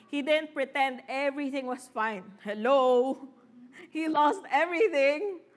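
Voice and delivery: ordinary talk, nothing marked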